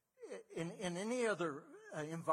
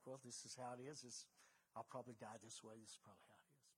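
resigned; 5 semitones lower, 14 dB quieter, faster than usual. Retold or imitated. Voicing a resigned amputee patient.

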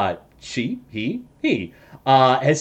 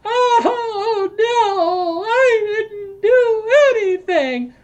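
put-on high, sobbing old woman's wail, much higher and slower; crying old woman; much slower than usual. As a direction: plead through tears from inside the barrel. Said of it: "pops her head out and goes".

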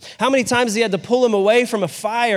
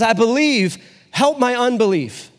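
higher, pleading retold outcry; imitated tearful cry; 4 semitones higher than usual. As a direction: plead earnestly, imitating a desperate father. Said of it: "he cries out with tears".

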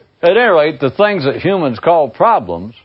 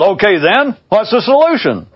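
high, raised-voice challenge; yelled question; 9 semitones higher than usual. A narrator's reading. Father testing his son further, yelling. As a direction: call out the follow-up question up high. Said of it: "then yelled".

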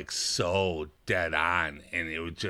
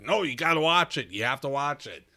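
retold insistence; 7 semitones higher than usual. Retelling urging people to watch.

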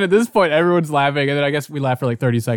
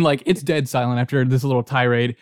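ordinary talk, nothing marked

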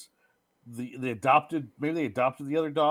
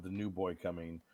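ordinary delivery

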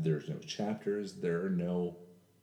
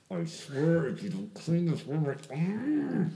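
exasperated parent; 9 semitones higher, slower than usual. Retold or imitated. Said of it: "I know you've yelled at your kids before".

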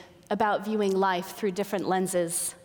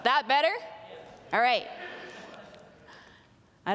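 raised, projected voice; 3 semitones higher than usual. Real, not acted. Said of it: "my big girl voice".

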